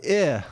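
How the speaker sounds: "I" as a short ih; short I sound. Shown as a demonstration. Teacher giving the correct sound, "I" as short ih. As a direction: say "I" as a lax, short ih. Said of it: "E instead of".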